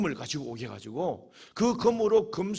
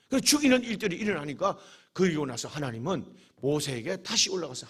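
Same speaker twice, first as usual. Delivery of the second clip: ordinary delivery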